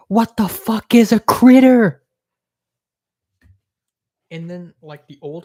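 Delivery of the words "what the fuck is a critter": loud, high, slow exasperated yell; incredulous outburst. Real, not acted. Vlogger mocking her host's word critter, loud slow disbelief. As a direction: drag out disgust at the word critter.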